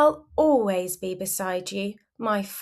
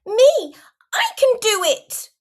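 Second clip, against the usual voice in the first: put-on very high, loud little-kid voice; high-pitched feisty yell; child's defiant shout; 12 semitones higher, 8 dB louder than usual. A narrator's reading. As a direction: shout it like a stubborn small child. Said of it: "You glare and shout".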